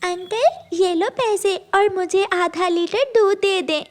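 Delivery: put-on high childlike pleading voice; little girl voice. (ऊँची आवाज़ में छोटी बच्ची)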